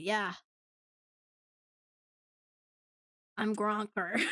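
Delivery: deadpan introduction, long pause before the name; straight-faced. Acted. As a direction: say it with a straight face. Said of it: "straight face".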